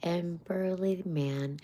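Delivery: usual speaking voice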